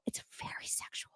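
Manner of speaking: voiceless stage whisper. Pretend. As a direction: drop to a secret whisper. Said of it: "whispered aside".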